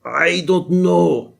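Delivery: hammed-up Austrian accent, drawn out; Arnold Schwarzenegger impression. Teacher imitating Schwarzenegger's Austrian accent for students. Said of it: "the way Arnold Schwarzenegger would say it".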